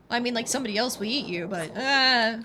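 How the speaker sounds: ordinary talk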